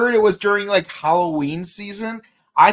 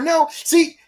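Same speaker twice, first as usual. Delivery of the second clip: usual speaking voice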